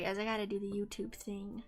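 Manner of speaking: ordinary talk, nothing marked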